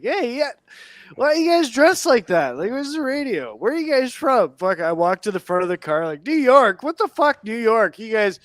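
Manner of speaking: put-on drunken idiot. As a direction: holler like a friendly drunk idiot. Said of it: "like a drunken idiot".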